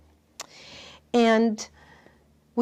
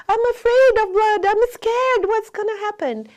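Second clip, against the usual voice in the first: put-on high, louder frightened child's voice; imitating a frightened little girl; scared little girl; 12 semitones higher, 7 dB louder than usual. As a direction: play the panicked girl, voice up high. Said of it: "So she would go".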